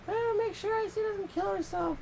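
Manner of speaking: mock fretting mother, very high; Cam. Friend mimicking a worried mother, high mock fretting. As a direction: imitate an obsessive mom in a high put-on voice. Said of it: "she was like".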